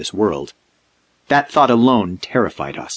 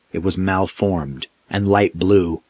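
usual speaking voice

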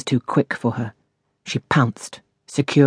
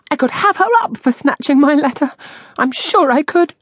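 high-pitched, aggrieved protest; indignant fuming; 12 semitones higher, 4 dB louder than usual. A narrator's reading. Miss Moss alone over her snatched letter, high indignation. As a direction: fume in a high, wronged voice.

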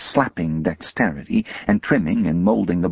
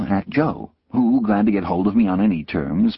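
ordinary delivery, nothing marked

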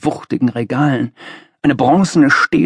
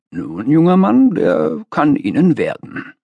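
lightly voiced master; 4 semitones higher than usual. A narrator's reading. Master granting a young man advice, condescending. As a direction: lightly play a self-important master.

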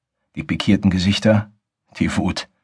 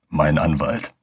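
quietly sarcastic; mocking undertone; 4 semitones lower, 3 dB quieter, slower than usual. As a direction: say it with a mocking edge. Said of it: "Mit spöttischem Unterton".

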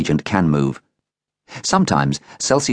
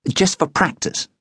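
put-on girl's voice; 11 semitones higher than usual. A narrator's reading. Girl trying to kiss boy.

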